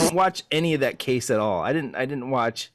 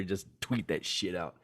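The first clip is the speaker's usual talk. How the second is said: usual speaking voice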